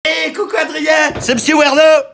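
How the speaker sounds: high, loud shouted hello to a fan; shouted greeting. Acted. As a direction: call out a big hello, voice way up. (salut crié très fort et aigu)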